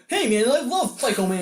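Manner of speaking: mock-excited fan voice; enthusiastic fan. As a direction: blurt it out like an eager fan. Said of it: "one guy just sat up like".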